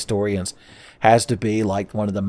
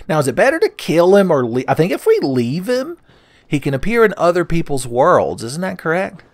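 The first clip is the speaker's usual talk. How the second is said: high-pitched voice; 8 semitones higher, 5 dB louder than usual. Acted. Keeps high voice while deciding.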